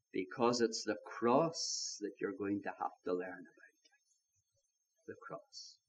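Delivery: low, slow and very soft; hushed solemn correction. Acted. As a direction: let it sink almost to a whisper. Preacher drops to solemn hush.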